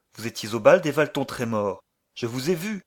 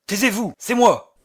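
sharp, high commanding order; violent authority; 7 semitones higher, 6 dB louder than usual. Acted. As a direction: silence her with force. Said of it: "avec une autorité violente".

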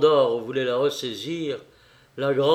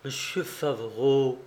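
ordinary delivery, nothing marked

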